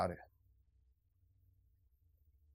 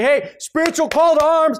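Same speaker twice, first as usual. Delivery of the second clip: a sudden, much higher mock call to arms; rallying cry; 16 dB louder than usual. Preacher mocking a late call to arms, shouted high.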